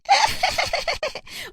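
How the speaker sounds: put-on very high-pitched girlish cackle; gleeful, jeering peal of laughter; mocking cackle. A girl laughs at her bald classmate, shrill mocking cackle.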